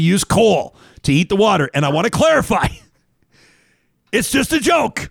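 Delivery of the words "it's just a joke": high, hammed-up protest; mock exasperated shout. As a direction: plead innocence with comic exasperation. Hammed-up clarification to upset listeners.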